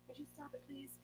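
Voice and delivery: whispered scold with a laugh. Real, not acted. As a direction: hush the cat without the mic catching it. Scolding cat mid-recording.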